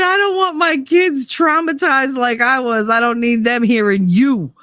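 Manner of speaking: high-pitched indignant protest; retold protest. Retold argument with her mother.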